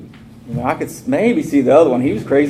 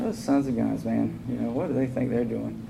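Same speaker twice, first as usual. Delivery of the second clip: much softer grumbling to himself; sulky mutter; 9 dB quieter than usual. Retold or imitated. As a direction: grumble under your breath, annoyed. Riding around mad, muttering.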